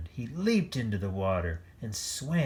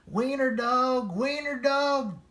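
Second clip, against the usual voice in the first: very high singsong taunt; taunting laughter; 5 dB louder than usual. A narrator's reading. Dogs laughing at Oscar, high singsong taunt. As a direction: chant it high like laughing bullies. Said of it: "laughed the other dogs".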